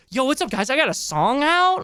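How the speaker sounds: high, excited YouTuber greeting; hyped YouTuber. Retold or imitated. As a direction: play a hyped-up streamer saying hi. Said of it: "And Tov's like".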